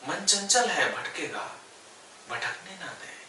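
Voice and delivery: unhurried yoga nidra guidance with long pauses; guided meditation. Real, not acted. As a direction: guide them gently into stillness, phrase by phrase. Leads yoga nidra body relaxation. (ठहर-ठहर कर योग निद्रा का निर्देश)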